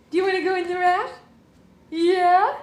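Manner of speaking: high, loud, fast singsong question. Real, not acted. Cooing to her baby.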